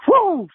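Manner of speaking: high, loud wrestling "Woo!"; wrestler woo. Retold or imitated. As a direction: let out a Ric Flair-style whoop. Wrestler quote, Ric Flair woo.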